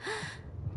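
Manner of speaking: soft startled intake of breath; sharp gasp. Acted. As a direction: gasp as if jolted awake. Startled by nightmare question.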